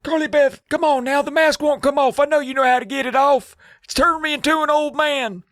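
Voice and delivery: put-on high, strained old man voice. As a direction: croak it out like a frail old man. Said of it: "he's like".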